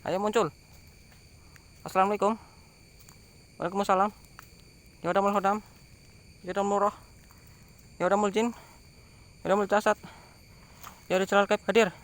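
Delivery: loud, high calling out to spirits; summoning call. Real, not acted. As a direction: call the spirits to appear, loudly.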